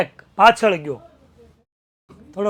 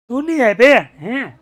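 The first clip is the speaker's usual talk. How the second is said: angry taunt; 4 semitones higher, 8 dB louder than usual. Retold or imitated. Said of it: "acted villain taunting".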